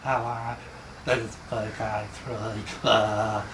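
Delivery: soft, low, distant mumble; badly held microphone. Shown as a demonstration. Showing learners bad microphone technique, soft low mumble. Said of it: "talking into 'em like this".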